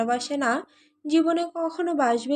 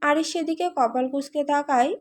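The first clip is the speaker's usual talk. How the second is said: ordinary delivery, nothing marked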